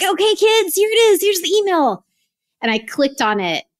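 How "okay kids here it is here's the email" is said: put-on high, excited call to the kids; excited announcement. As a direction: build up the big reveal for the kids. Gathering kids to show email.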